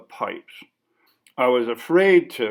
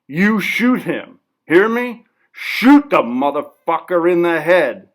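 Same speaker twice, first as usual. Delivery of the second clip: louder, higher, insistent orders; commanding shout; 5 semitones higher, 8 dB louder than usual. Acted. Artist ordering the interviewer to hold the gun on him, loud. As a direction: force the gun into his hands.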